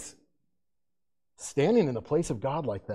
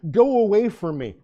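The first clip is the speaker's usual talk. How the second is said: Peter's plea; 5 semitones higher, 5 dB louder than usual. Acted. Peter voiced flopping before Jesus.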